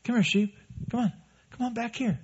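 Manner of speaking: high, soft coaxing call to a sheep; gentle coaxing. Pretend. Kind shepherd calling a lost sheep, soft high coaxing. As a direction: coax it home like a tender shepherd. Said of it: "It's the kindness".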